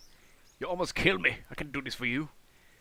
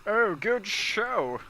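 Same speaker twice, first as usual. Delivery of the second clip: loud, drawn-out mock-posh "good show"; exaggerated slow mock upper-class echo; mimicking posh accent; 9 semitones higher, 7 dB louder, much slower than usual. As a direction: stretch it out, hamming the toff accent. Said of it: "What's that accent?".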